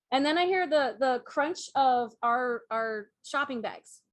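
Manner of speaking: ordinary talk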